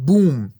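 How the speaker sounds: loud imitated explosion; a punchy explosion sound; boom. Shown as a demonstration. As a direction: burst out the boom like a blast. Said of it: "صدای انفجار".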